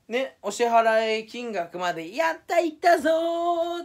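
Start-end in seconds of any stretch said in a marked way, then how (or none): 2.1-3.8 high, loud, drawn-out cheer; excited cheer. Retold or imitated. Celebrating reaching the payout amount, high loud cheer. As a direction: cheer like you finally made it. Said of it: "って言って盛り上がってた".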